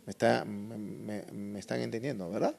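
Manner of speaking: normal talking voice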